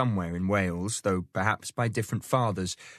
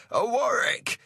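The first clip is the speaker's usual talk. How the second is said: high ringing call across the water; a high hailing cry; 3 dB louder than usual. A narrator's reading. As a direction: hail the other ship from afar. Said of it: "he cries".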